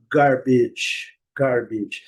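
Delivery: "garbage" with an American R in GAR; American model pronunciation, "GAR-bidge" with audible R; American English. Shown as a demonstration. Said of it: "do inglês americano".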